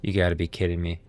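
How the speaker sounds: ordinary delivery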